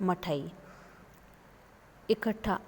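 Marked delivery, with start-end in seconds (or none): 0.0-0.5 मठाई with the first vowel dropped; Khari Boli form. Shown as a demonstration. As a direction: clip मिठाई to मठाई. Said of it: "खड़ी बोली में कैसे बोला जाता है". (खड़ी बोली में मठाई)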